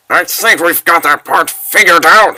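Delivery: ordinary talk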